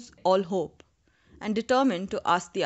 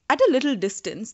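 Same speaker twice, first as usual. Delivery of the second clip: usual speaking voice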